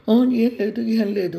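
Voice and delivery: put-on child catching himself, hastily covering up; sudden panicked denial. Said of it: "ఏదో గుర్తు వచ్చిన వాళ్ళ గబాల్న నోరు మూసేసుకొని". (భయంతో గబాల్న తిరస్కరించడం)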